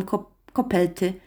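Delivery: "kopelty" with L in place of R; little child's "kopelty", R turned into L; cannot say R. A narrator's reading. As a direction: stumble on "ko-", then say "kopelty" with an L for the R. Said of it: "który nie wymawia ry".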